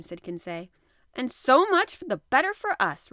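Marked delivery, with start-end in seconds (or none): 1.1-3.1 higher, much louder comeback; Ned's loud, raised-pitch retort; hearty retort. A narrator's reading. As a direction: fire back with gusto as the harpooner. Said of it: "retorted Ned Land".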